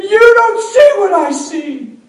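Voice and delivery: high, loud and slow, furious son; angry shout. Retold or imitated. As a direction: hurl it slowly at him. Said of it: "He was mad".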